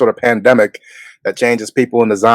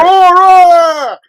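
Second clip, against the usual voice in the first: high, ringing call of a name across a distance; 4 dB louder than usual. Retold or imitated. Calling to a character on the show, a high ringing shout. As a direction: yell the name out high like calling someone far off.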